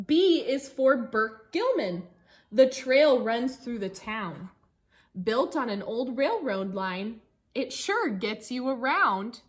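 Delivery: lively storybook reading for a class; reading to children. Acted. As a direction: read the rhyme aloud to the kids, bouncy. Teacher reads picture book aloud.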